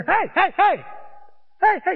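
sharp high hey-shouts; imitated yelling. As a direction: yell into someone's ear to rouse them. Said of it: "we yell in both ears".

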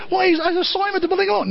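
high, rushed, tumbling words; frantic George. Retold or imitated. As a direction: George blurts to his mother, fast and high.

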